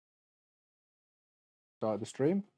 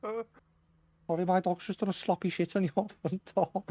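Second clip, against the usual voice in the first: much higher Scouse apology, laughing; breezy Liverpool accent, giggling mid-line; Paddy the Baddy; 8 semitones higher than usual. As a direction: doorbell clip voiced in Scouse. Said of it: "Ring camera where he's walking his dog".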